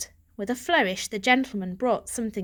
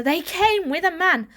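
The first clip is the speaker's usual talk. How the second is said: raised, dismissive mother tone; a hint of a patient mother; lightly voiced mother; 8 semitones higher, 5 dB louder than usual. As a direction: brush the worry aside.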